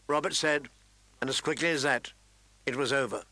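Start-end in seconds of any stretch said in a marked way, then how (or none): none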